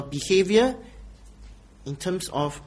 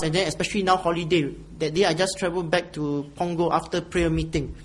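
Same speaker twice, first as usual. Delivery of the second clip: ordinary talk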